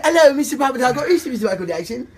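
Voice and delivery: high, loud mock British phone voice; hammed-up British caller impression, pitched high. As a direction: announce the names in a put-on British accent. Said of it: "[name] called me with a British voice".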